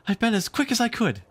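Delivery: put-on high, breathless girl's voice; panting. Wendy rushes back with the glass, high and panting. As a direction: gasp it out after running. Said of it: "she panted".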